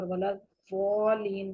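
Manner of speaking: الضالین with ض read as ز, slow; wrong tajweed. Shown as a demonstration. Teacher demonstrates the mistake students make. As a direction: slowly say الضالین as زالین. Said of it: "ضاد کو ہم نے زا پڑھ لیا".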